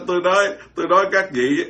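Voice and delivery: ordinary delivery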